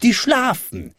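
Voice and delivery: high, lightly called answer; a high-pitched call, not a full shout. A narrator's reading. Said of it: "rief der goldene Hahn herunter".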